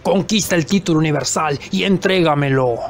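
voz grave de jefe de secta; líder autoritario. Acted. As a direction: ordena en tono bajo, como villano. (líder exigiendo obediencia, en grave)

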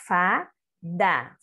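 FADA drawn out as FA, DA; teacher reading Fada slowly as FA-DA; syllable-by-syllable reading. Shown as a demonstration. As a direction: sound out Fada in two clear syllables. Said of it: "Vamos ver a palavrinha".

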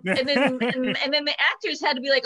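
ordinary talk

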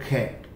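"खैट" with a light kh at the start; "खैट" with a soft breathy k. Shown as a demonstration. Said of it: "हल्का सा soft ख".

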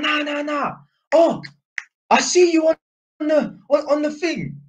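high-pitched excited Cockney impression; Cockney accent. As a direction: put on a high Cockney geezer voice. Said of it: "that's how I'm doing a Cockney accent".

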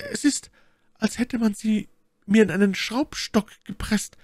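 high falsetto lady's question; My Lady, distressed. A narrator's reading. Lady discovering her hurt hands, high and troubled. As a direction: ask in a pained, high-pitched lady voice.

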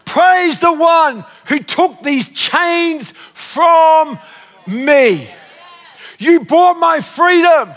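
high, very slow proclamation; impassioned preaching. Real, not acted. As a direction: stretch out every key word in praise. Declaims song words as preaching.

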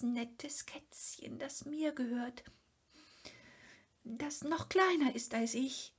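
high, small creature voice, pleading; a timid little animal begging for a kitten; Sniff's wishing thought. Acted. Sniff prays to the protector of small animals, high and pleading.

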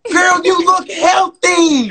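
slow, high, wailing praise; hammed-up crying praise; mock church lady.

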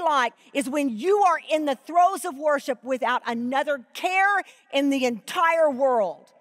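impassioned preacher building to worship; preaching. Acted. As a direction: preach it with full conviction. Preaching with rising intensity.

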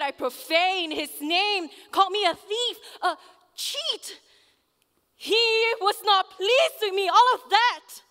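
loud, high-pitched, impassioned cry; anguished outburst. Acted. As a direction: build to a pained, high shout. Recounts his angry accusations.

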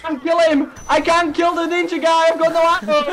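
high, loud and frantic; frantic panic. Real, not acted. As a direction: panic as the horde closes. Out of ammo, swarmed.

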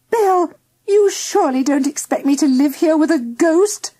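high, upset wife's protest; distraught cry. A narrator's reading. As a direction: appeal to a husband in dismay. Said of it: "cried Missus Phillips, not her usual self at all".

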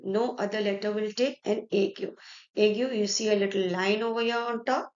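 ordinary talk

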